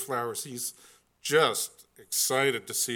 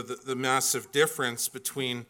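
usual speaking voice

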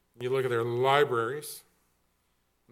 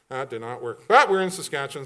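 ordinary talk

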